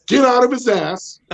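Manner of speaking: high yell at the dogs. Retold or imitated. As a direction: holler it at the dog. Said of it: "the three things that I pretty much yell".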